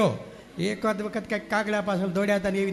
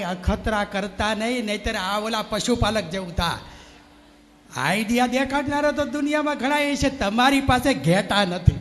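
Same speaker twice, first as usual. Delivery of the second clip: preaching; 5 dB louder than usual. Acted. Preaches to parents.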